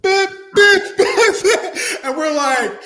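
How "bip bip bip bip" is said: high, loud imitated punches; sharp little bursts for each blow; punch sound effects. Storyteller acting out his friend's punches, mimicked hits.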